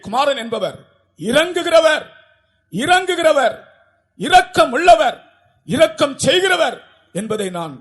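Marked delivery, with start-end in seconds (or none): 1.3-6.8 preaching emphasis. Acted. Preacher proclaims God's mercy.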